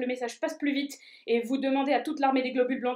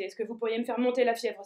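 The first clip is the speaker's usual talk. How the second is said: ordinary delivery, nothing marked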